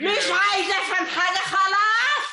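high, shouted refusal; angry. Acted. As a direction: shut the argument down in anger. Aunt cuts him off. (رفض غاضب بصوت عالٍ)

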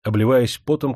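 plain reading voice

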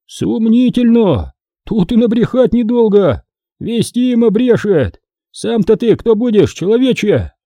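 high-pitched heckling villagers; doubtful jeers, pitched well up; sceptical peasants. A narrator's reading. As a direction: heckle the speaker from the crowd. Doubting voices from the crowd.

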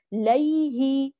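"ليه" stretched, sound running on to the haa; very slow, continuous glide in "ليه"; uncut flowing yaa. Shown as a demonstration. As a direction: draw out "ليه" without cutting the y. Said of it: "جرى، أنا ما قطعته".

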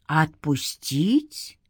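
low, soft, very slow question, rising at the end; mocking undead echo. A narrator's reading. An undead spirit echoing a captive's plea, mocking. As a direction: stretch it out as a sinister echo.

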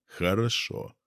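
ordinary delivery, nothing marked

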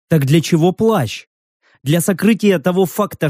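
usual speaking voice

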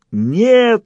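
high, loud wail of refusal; howled cry. A narrator's reading. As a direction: howl it out in protest. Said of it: "взвыл бог".

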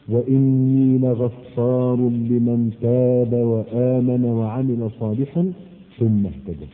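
low, slow chanted verse; slow Quranic recitation. Acted.

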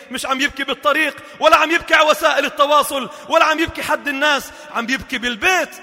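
loud, high pleading sermon; fervent loud preaching; impassioned preaching. Acted. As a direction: plead from the pulpit. Preaching at full force.

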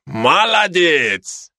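very slow, drawn-out Georgian praise; Georgian accent. Acted. Georgian soldier praising the Kazakh, in a Georgian accent. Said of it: "каждый со своим акцентом".

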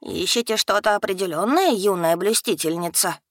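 usual speaking voice

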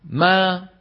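ما released from closed lips into an open long aa; meem with fatha. Shown as a demonstration. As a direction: press the lips, then open wide on ما. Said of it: "لما بدك تنطق ميم مفتوحة". (ما بميم مفتوحة وألف ممدودة)

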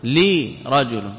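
لرجل slowly, opening on a drawn-out "li"; slow "li... rajul" for لرجل, kasra on the lam. Shown as a demonstration. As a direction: set "li" apart, then "rajul", in لرجل. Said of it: "إذا قرأت ووجدت الكسرة".

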